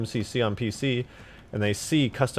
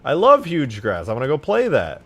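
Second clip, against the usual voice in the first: loud, higher, thrilled gamer voice; excited gamer quote; 4 semitones higher, 6 dB louder than usual. Retold or imitated. Streamer imitating a hyped player, loud and eager. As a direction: play the player who just spotted his favorite map.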